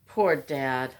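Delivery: ordinary talk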